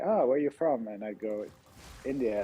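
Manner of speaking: usual speaking voice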